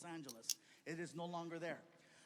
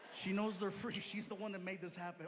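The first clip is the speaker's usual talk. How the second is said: ordinary talk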